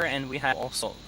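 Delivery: ordinary talk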